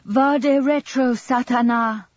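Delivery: very high, loud, drawn-out exorcism cry; high-pitched, slow ritual cry at full voice; nuns' pursuing cries. A narrator's reading. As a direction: drive the devil off with a slow, soaring shout. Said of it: "pursued him with their cries".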